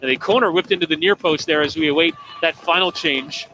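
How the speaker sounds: usual speaking voice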